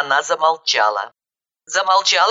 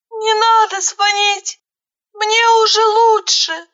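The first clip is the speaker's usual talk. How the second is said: high, slow, wailing plea; pained pleading; 4 dB louder than usual. A narrator's reading. Injured girl begging her not to call doctors, pained. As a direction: beg through pain in a thin high voice. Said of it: "Голос её переполняли боль и мольба".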